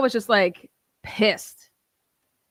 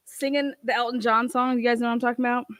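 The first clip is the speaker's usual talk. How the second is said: ordinary delivery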